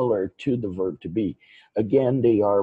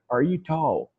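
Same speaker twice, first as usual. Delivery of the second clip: "Are you tall?" asked with pitch lifted high; question intonation; 4 semitones higher than usual. Shown as a demonstration. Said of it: "make it sound like a question".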